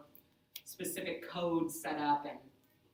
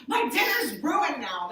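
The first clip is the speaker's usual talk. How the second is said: higher, louder, slow whine; whiny complaint; 8 semitones higher, 8 dB louder than usual. Retold or imitated. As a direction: moan it like a spoiled meal is a tragedy. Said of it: "she said it just like that".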